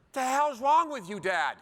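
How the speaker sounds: put-on upset child. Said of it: "sitting on the curb crying".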